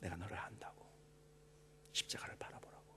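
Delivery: soft unvoiced whisper; hushed voice of God. Pastor voicing God's words to the congregation, whispered. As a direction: breathe the Lord's words gently. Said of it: "주님께서 우리에게 말씀하시는 거예요".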